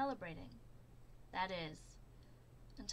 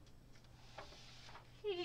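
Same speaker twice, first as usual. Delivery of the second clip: high unvoiced chuckle; breathy giggle; 12 semitones higher, 6 dB quieter than usual. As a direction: giggle at the joke.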